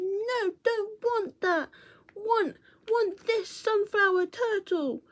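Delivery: put-on very high, slow toddler-like insistence; stubborn little girl. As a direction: whine each word like a stubborn small child. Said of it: "she was going".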